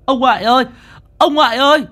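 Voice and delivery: put-on high, loud child's cry for grandpa. A narrator's reading. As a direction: call out desperately to grandpa. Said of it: "nó kêu lên". (trẻ con kêu to gọi ông ngoại)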